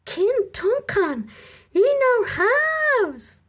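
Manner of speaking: very high, loud, slow thrilled exclamation; thrilled wife crying out the news; excited cry. Acted. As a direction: burst out with delight. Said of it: "Lady Macbeth cried excitedly".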